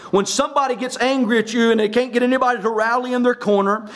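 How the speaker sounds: ordinary talk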